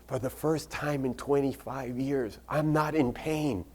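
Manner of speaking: soft, high voice of wonder; hushed amazement. Retold or imitated. Patient reports first relief in twenty-five years, hushed amazement. Patient's pain-free moment retold.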